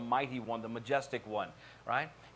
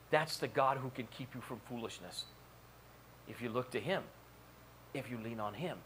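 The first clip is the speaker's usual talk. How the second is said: softer and lower, with long pauses; hushed, solemn preaching; 3 semitones lower, 6 dB quieter than usual. Acted. Preacher assuring congregation God is bigger than their problems, hushed preaching. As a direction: let each reassurance settle quietly.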